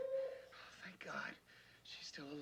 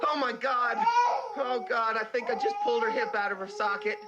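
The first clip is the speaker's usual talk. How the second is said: real anguished cry, higher and louder; distressed crying shout; 6 semitones higher, 3 dB louder than usual. Real, not acted. Rescuer fears he hurt the trapped baby, crying out. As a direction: cry out in panic.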